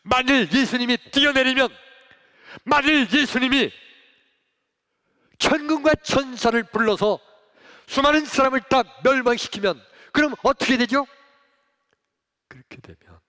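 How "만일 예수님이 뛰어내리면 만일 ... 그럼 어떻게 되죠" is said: loud, high preaching voice; fervent preaching. Acted. Pastor urging the congregation, fervent loud preaching. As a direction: preach it with full force.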